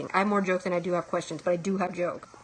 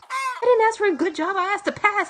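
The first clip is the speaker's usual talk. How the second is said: high, louder pleading whine; indignant whine; 10 semitones higher, 4 dB louder than usual. Retold or imitated. Student to the NASA professor, a high indignant whine. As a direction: whine back at the teacher, pitch up. Retorting to the teacher.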